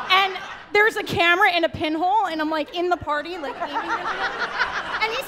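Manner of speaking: usual speaking voice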